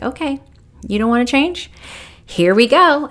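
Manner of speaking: playful ominous universe; universe talking. Retold or imitated. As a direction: voice the universe calling her bluff. Universe voiced as warning.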